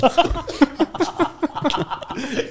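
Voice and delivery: high, wheezy laugh; long breathless giggle; helpless laughter. Real, not acted. Laughing at acted tribesman insult.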